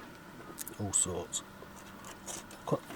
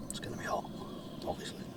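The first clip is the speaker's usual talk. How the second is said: whispered to himself; whispering; 12 dB quieter, much faster than usual. Real, not acted. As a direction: whisper it under your breath. Checking hot pasta.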